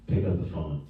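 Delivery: normal talking voice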